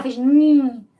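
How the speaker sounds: "N" said as the nh sound. Shown as a demonstration. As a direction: say "N" as the nasal nh. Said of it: "resolveu dar a mão pro H". (som de "nh" no "N")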